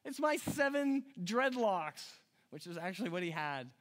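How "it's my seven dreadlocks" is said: high, slowed exasperated confession; frustrated. Acted. Samson revealing his secret to her, frustrated. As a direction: spell it out in acted exasperation. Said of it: "He's so frustrated. He's like".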